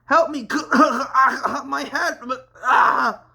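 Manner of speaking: high, loud cries of pain; a strained, crying plea. Acted. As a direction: beg for help while the head hurts. Possessed Matt begging in pain.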